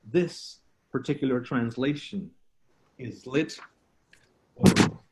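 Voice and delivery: measured spoken-word with long pauses; deliberate bilingual poem delivery; poetry recital. Acted. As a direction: recite it in short, separated phrases. Performs improvised bilingual poem.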